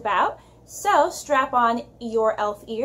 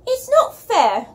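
put-on slow, high, loud British child's complaint; British accent; 10 semitones higher, 7 dB louder, slower than usual. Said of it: "attempt to do a British accent".

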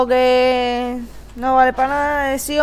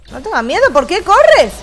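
taunting; 7 semitones higher, 8 dB louder than usual. Real, not acted. Taunting a fleeing enemy.

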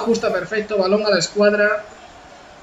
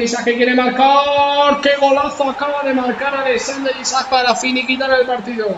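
excited goal call; 5 semitones higher than usual. Real, not acted. Clinching goal scored.

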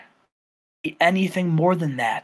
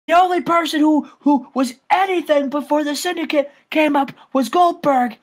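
Scott Steiner; 9 semitones higher than usual. Retold or imitated. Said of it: "he said".

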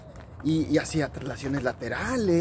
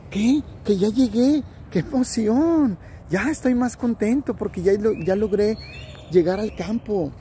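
higher, excited cry of joy on arrival; thrilled child; 6 semitones higher than usual. Acted. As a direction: burst out, delighted to have made it. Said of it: "y dijo". (niño emocionado, voz aguda de alegría)

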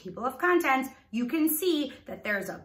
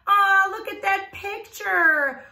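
high, louder delighted exclaim; excited; 9 semitones higher, 5 dB louder than usual. Acted. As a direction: draw the kids in with excitement. Teacher shows children a picture.

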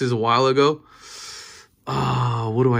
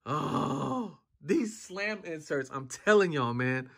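much softer, slow, hushed admiration; awed hush; 3 semitones higher, 10 dB quieter, slower than usual. Real, not acted. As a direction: murmur it in awe. Awed by the inserts.

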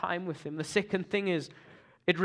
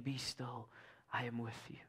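much softer whispered reassurance; hushed tender Holy Spirit voice; soft still whisper; 5 semitones lower, 8 dB quieter than usual. Retold or imitated. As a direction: breathe it like a gentle inner whisper. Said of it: "the soft, still whisper of the Holy Spirit".

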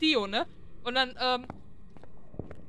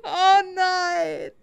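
mock dismay; 5 semitones higher, 4 dB louder than usual. Real, not acted. Mourns lost ghost.

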